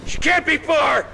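loud, high refusal; desperate shout. Acted. Lynch shouting at the dealer, refusing to leave Sue.

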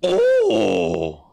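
sudden high yelp of surprise; sharp startled oh; startled cry. Real, not acted. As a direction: jump at what you see. Startled in creepy level.

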